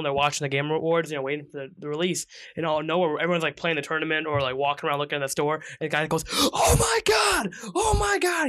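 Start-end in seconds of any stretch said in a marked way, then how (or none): 6.3-8.5 high, piercing excited scream of a stranger; hammed-up high shriek. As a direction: shriek it out at the top of your lungs. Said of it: "He just starts screaming out the top of his lungs".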